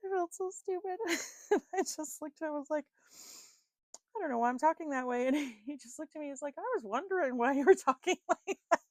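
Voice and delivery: high-pitched, helpless giggly telling; laughing while talking. Real, not acted. Owning up to an embarrassing accent slip, laughing. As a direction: crack up as you tell it.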